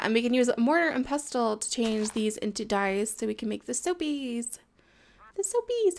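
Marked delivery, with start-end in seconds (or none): 5.4-6.0 excited squeal. Real, not acted. Excited echo of own word.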